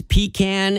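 pecan said as PEE-can; PEE-can pronunciation. Shown as a demonstration. Radio host names a word people mispronounce, saying pecan as PEE-can. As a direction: stress the first syllable, PEE-can, for pecan. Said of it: "I don't know if I should be saying pecan".

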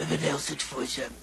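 low, soft, weak voice; laryngitic croak. Acted. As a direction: give the number with a lost voice. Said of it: "she can't hear me".

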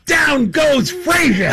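high, drawn-out imitation of a scream. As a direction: scream it like a ringside call, stretching each word. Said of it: "who sits back and just screams".

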